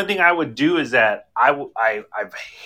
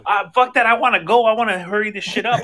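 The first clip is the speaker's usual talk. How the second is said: impatient, high voice; 7 semitones higher than usual. Retold or imitated. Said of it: "I'm like".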